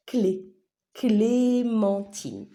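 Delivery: "Clé" alone, then "Clémentine" slowly syllable by syllable; single out CLÉ, then stretch the whole word; first syllable isolated. Shown as a demonstration. Teacher pointing out the first syllable, slow and emphatic. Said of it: "vous l'entendez sur la première syllabe".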